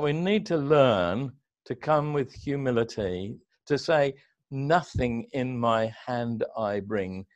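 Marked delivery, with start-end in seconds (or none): 4.5-7.3 slow, soft and lower; humble reverent recital. Real, not acted. Reciting hymn lines humbly.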